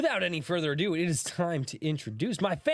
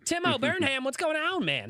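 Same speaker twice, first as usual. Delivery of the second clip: much higher-pitched greeting; pained greeting; 12 semitones higher than usual. Real, not acted. Host greeting a chatter by a name he hates saying, pained. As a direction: push the name up high, as if it hurts to say. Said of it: "hurts my soul to say it like that".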